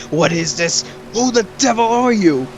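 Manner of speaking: Sarutobi. Retold or imitated. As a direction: imitate an old master aghast at what appears. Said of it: "Even the third Hokage is like, looks like he's gonna have a heart attack".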